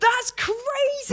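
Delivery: high, slow amazed that's crazy. Real, not acted. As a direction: stretch it out in disbelief. Shock at voiceover news.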